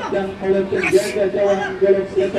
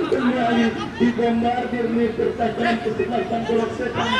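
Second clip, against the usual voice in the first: ordinary talk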